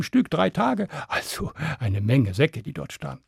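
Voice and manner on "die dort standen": plain reading voice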